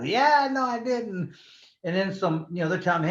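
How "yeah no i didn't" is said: high-pitched retold insistence; insistent comeback. Insists to disbelieving family.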